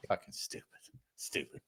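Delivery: whispered, disgusted grumble; muttered disgust. Retold or imitated. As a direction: mutter it under the breath. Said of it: "And I was like".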